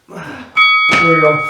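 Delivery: slow strained push; straining effort. Real, not acted. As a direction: squeeze it out under effort. Straining during flutter kicks.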